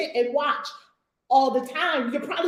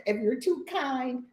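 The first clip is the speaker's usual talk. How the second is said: usual speaking voice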